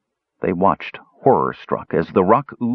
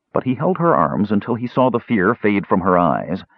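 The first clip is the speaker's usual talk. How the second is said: ordinary delivery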